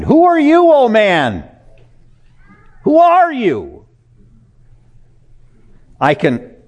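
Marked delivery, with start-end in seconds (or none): 0.0-3.8 high, loud preacher's cry; impassioned shout from the pulpit; thundering challenge. Real, not acted. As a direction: hurl the question out at full force. Preacher thunders the verse at listeners.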